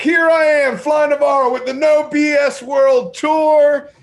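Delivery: loud, high, drawn-out show opening; big live-stream intro, easing down toward the hand-over; show announcer. Acted. Host hailing viewers as the show returns, booming announcer.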